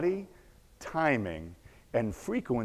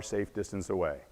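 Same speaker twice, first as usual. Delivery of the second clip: plain reading voice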